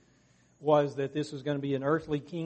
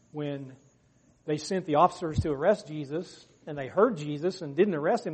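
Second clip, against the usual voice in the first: normal talking voice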